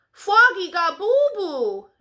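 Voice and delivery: a lightly voiced little sister teasing. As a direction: tease him like a little sister. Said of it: "giggled his sister Polly".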